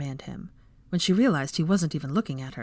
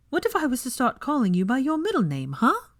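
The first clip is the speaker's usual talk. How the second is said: higher, stern challenge; 8 semitones higher than usual. Acted. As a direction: scold him with a threat. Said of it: "she asked sternly".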